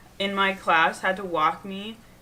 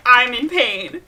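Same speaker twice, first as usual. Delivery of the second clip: fake crying; 3 semitones higher, 8 dB louder, slower than usual. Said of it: "We're gonna put on the dramatics".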